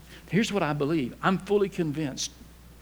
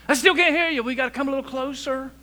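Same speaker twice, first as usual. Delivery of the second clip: much higher imitated child's voice, loud at first; insistent grandkid; 8 semitones higher, 4 dB louder, faster than usual. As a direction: play a kid straining to hear Granddad. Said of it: "because they'd say".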